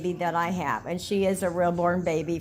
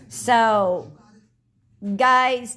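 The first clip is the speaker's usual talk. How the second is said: calling out to viewers; 4 semitones higher, 6 dB louder than usual. Real, not acted.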